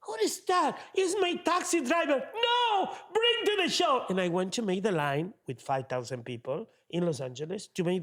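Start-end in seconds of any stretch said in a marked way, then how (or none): 0.0-4.0 excited producer. Retold or imitated.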